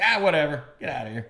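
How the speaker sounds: high, loud, dismissive wave-off; a loud, careless get-lost; dismissive brush-off. Retold or imitated. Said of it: "You're like".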